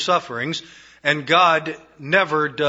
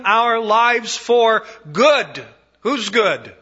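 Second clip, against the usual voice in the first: high, loud, full-force preaching; fervent preaching; 7 semitones higher, 3 dB louder than usual. Acted. Preacher stirring the suffering congregation, fervent and loud. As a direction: preach it out with rising passion.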